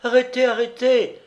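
a wife shouting to stop the cart; crying out. Acted. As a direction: call "Arrêtez" across the fields, voice up high. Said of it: "s'écria une voix de femme".

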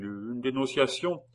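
plain reading voice

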